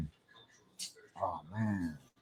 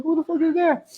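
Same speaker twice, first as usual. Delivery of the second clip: startled; 11 semitones higher than usual. Retold or imitated. Spotting a stranger staring.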